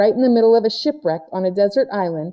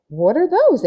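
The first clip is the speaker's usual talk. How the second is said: curious question; 9 semitones higher, 4 dB louder than usual. A narrator's reading. April spots paper dolls.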